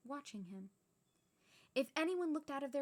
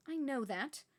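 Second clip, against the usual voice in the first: short irritated retort; irritable; 4 semitones higher, 4 dB louder than usual. Acted. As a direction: snap back, annoyed. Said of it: "said Professor McGonagall irritably".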